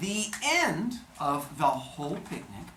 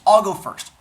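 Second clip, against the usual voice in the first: loud, cocky announcement; boastful; 5 semitones higher, 12 dB louder than usual. Acted. Zeus eagerly starting the shapeshifting contest, loud and cocky. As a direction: claim the first turn with swagger, much louder. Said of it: "So he goes".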